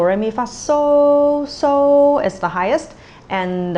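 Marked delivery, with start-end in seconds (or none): 0.0-2.2 rising solfege scale. Shown as a demonstration. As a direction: climb up the scale do re mi fa sol. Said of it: "our first tone is do".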